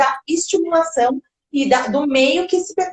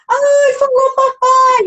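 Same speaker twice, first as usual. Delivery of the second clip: very high, loud, drawn-out coo; slow sing-song squeal of delight; delighted babytalk; 12 semitones higher, 5 dB louder, much slower than usual. Acted. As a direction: celebrate a baby's first word with melody. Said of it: "um prazer naquela melodia".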